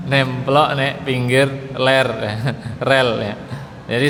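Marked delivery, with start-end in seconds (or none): 0.1-2.1 nemplok nek pinggir ler slow, r rolled hard; slow tongue twister with heavy rolled r; rolled R drill. Retold or imitated. Teacher recalls the old rolled R drill, laughing. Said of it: "untuk latihan R".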